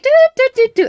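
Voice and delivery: high, loud rapid-fire do-do-do; rapid mock tune. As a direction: rattle it off like a racing soundtrack. And just at such a speed.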